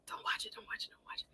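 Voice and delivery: breathy whispered warning to herself, very soft; urgent whisper. Retold or imitated. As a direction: hush it, as if talking yourself out of it. Telling herself not to watch news.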